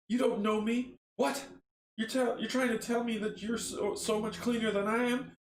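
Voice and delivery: high-pitched, agitated retort; indignant protest. Acted. Arthur argues with his accusers, indignant protest.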